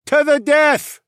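very high, loud creature cheer; Tunglians' echo. Acted. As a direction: echo it back as a high-pitched shout. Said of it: "the Tunglians echoed".

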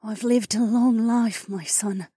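high, slow, breathy mother; gentle consolation. Acted. Dying mother consoling her son, gentle and breathy.

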